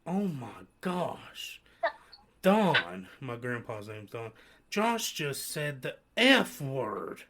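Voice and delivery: put-on slow, gasping old lady tattling with a loud "Don"; scandalized grandma. Grandma tattling to grandpa in the car, shocked and slow. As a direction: drag out "Oh my gosh" like a shocked churchgoing grandmother. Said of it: "And she said".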